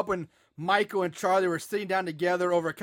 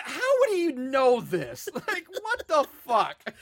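slow, higher words through laughter; laughing disbelief; 5 semitones higher, slower than usual. Real, not acted. Asking his co-host in disbelief, laughing and higher. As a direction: laugh out the outrage.

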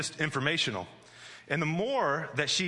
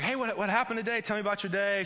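put-on higher-pitched, upbeat dad to a toddler; dad talking to child; 7 semitones higher, faster than usual. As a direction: ask it high, as to a small child. Dad greeting his little daughter.

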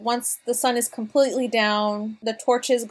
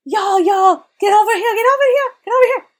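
higher, louder, beckoning the friends over with excitement; excited dwarf calling; 11 semitones higher, 7 dB louder, faster than usual. Acted. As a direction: call them over, overjoyed and loud. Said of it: "and he's like".

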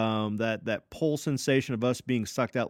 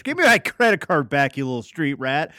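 retold angry yell; 3 semitones higher, 7 dB louder than usual. Me to the scamming street kid, a mock angry yell. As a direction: bark it back like you won't be scammed. Retold yelling at scam vendor.

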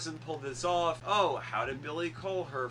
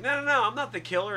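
high, fast, louder mock protest; retold frantic denial; 8 semitones higher, 5 dB louder, faster than usual. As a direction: imitate a hurried, panicky plea of innocence. Acting out Randy's frantic denial.

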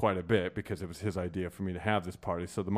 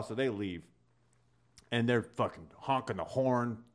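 ordinary talk

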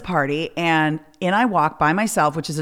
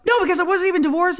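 much higher, fast indignant no; indignant protest; 13 semitones higher, faster than usual. Retold or imitated. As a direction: rush the protest in outrage. Confronting Kathy.

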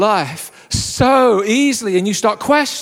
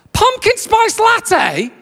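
very high, slow, drawn-out disbelief; incredulous drive-through voice; 13 semitones higher, much slower than usual. Retold or imitated. Starbucks worker querying the order over the speaker, incredulous. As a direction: screech the order back as a hammed-up question. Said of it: "comes back at me".

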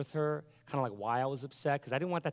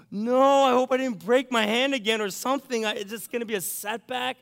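higher and louder, alarmed; panicked worry; 10 semitones higher, 10 dB louder than usual. Retold or imitated. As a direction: blurt the fear that the hand is broken. Gasps, fears broken hand.

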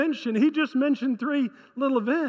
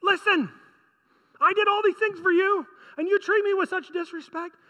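higher-pitched, exasperated reproach; irritated; 6 semitones higher than usual. Acted. Preacher voices God reproaching an ungrateful people, irritated. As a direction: plead your case like a fed-up parent. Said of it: "Can you hear the irritation in God's voice?".

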